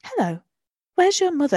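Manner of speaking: a kindly stranger with something hidden; lightly voiced disguised witch; sweet old lady voice. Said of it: "they obviously sound identical".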